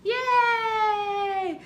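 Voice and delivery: high loud yay; cheer. Real, not acted. Mom cheering at the end of song.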